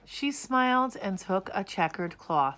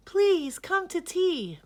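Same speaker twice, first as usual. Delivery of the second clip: high, louder calling voice; cried out; 12 semitones higher, 6 dB louder than usual. A narrator's reading. Miss Spider calling bumblebees to tea, high and eager. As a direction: call the bees in, high and eager. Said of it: "Miss Spider cried".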